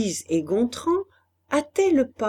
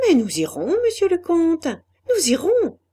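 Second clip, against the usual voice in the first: eager assent; 8 semitones higher, 5 dB louder than usual. A narrator's reading. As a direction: agree eagerly, swinging up high. Madame Honorat eagerly agrees with the count. (acquiescement empressé, aigu et chantant)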